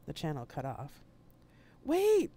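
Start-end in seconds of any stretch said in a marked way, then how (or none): 1.9-2.3 shouted much higher and louder; desperate yell. Acted. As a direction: yell as the channel cuts off. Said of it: "I yelled".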